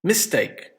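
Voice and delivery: MIS-take, MIS pitched high; stress on first syllable. Shown as a demonstration. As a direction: say Mistake with the weight on Mis. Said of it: "stress, on the first syllable".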